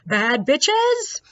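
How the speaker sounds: drawn-out, high mock shout; mock scream. Friend gathering people for the tour, mock scream. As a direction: call it out high and loud to the street. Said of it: "she always just screams out".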